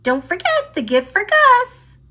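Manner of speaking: high, squeaky mouse voice urging hurry; Squeak voice. Acted. Squeak to Pip, fretting they're late, in a high squeaky voice.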